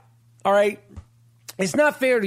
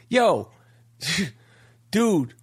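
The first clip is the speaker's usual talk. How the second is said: normal talking voice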